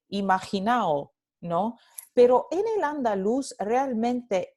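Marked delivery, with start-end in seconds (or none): 0.1-1.1 "imaginao" with no D, ending in a-o; dropped D. Shown as a demonstration. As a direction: finish "imaginao" on gliding vowels. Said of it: "la D intervocálica se elide".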